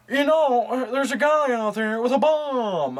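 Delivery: high nasal Nicolas Cage voice, slow; Nicolas Cage impression. Movie fan mimics Nicolas Cage warning of a bomb, high and nasal. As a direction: whine it high through the nose. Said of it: "very nasally voice".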